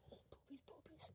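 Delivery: breathy whispered aside. Real, not acted. Joking to herself about the dog, whispered. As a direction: whisper it like a secret joke.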